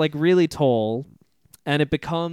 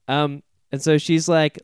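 usual speaking voice

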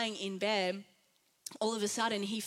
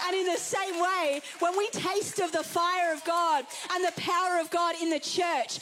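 fiery preaching; 4 semitones higher than usual. Real, not acted. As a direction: preach it with rising fire. Preaching at full force.

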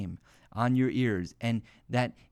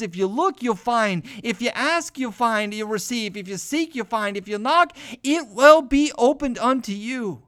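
rising louder and higher to a climax; building scripture quote; 7 semitones higher, 7 dB louder than usual. Acted. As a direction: build each line to a shouted finish.

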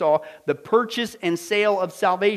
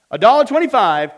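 auctioneer call; 4 semitones higher, 10 dB louder than usual. Retold or imitated.

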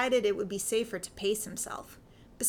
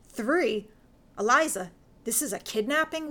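louder, rising alarm at the news; horrified; 4 dB louder than usual. A narrator's reading. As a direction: blurt it out in growing shock.

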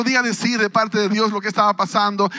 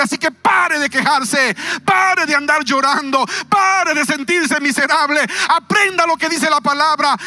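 preaching at full force; 4 semitones higher than usual. Acted. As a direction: preach it out, building to shouts. (predicación a todo pulmón, cada vez más alta)

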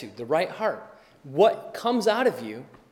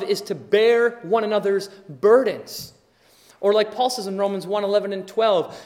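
ordinary delivery

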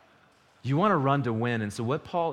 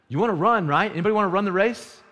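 loud, high, fast rally; rallying call; 7 semitones higher, 7 dB louder than usual. Acted. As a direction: pump up the crowd. Rallying the congregation.

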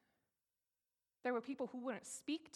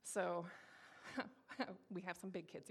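ordinary talk

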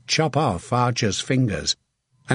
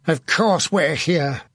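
harsh, slow and higher-pitched; 4 semitones higher, slower than usual. A narrator's reading. Saint answering a wondering archer, harsh. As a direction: rebuke with slow, impatient force. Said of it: "Saint Crispin said harshly".

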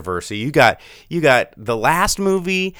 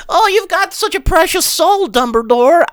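much higher, louder cute creature squeak; little animal voice; 5 dB louder than usual. Retold or imitated. Fan mocking the creature praising Dumbledore, high squeaky voice. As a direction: squeak it like a tiny fawning creature. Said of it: "the little animal goes".

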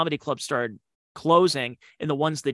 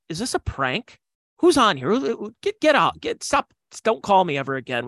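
mock angry hang-up; 6 semitones higher, 4 dB louder than usual. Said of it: "Otto's like".

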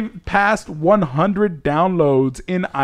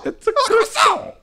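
high, slow mock French accent; over-the-top French, drawn out; slower than usual. Hosts joking about accents, slow high mock French. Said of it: "we all just went into accents".

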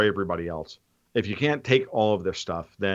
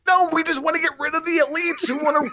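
high, put-on everyman voice; mock British commoner; 3 dB louder than usual. Answering his mock bigot with a high, put-on common-folk voice. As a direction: play a sarcastic ordinary Brit.

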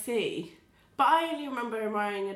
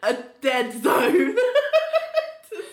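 laughing; 12 semitones higher, 5 dB louder than usual. Real, not acted. Laughing through explanation.

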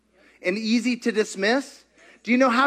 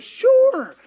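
eager sure; 6 dB louder than usual. Retold or imitated. Lady agrees to prayer.

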